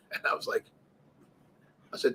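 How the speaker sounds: ordinary talk, nothing marked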